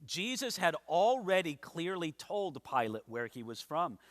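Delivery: ordinary talk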